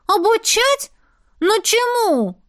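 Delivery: put-on high, surprised kid voice; high pixie voice. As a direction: protest like a startled pixie. Pixies protest teaching.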